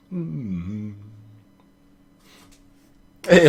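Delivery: normal talking voice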